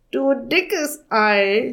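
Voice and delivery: high, slow rasping raven. Acted. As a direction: rasp it out in amazement. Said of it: "schnarrte er".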